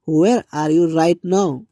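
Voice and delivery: very slow and even, each word apart; slow model sentence. Shown as a demonstration. Teacher modelling the question for students, very slow. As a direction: lay it out slowly for learners.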